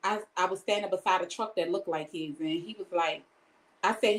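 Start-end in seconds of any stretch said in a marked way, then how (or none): none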